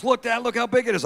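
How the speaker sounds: imitated kid, high and eager; excited little kid. Son showing off to his dad, excited high kid voice. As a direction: pitch it up like a boy showing off.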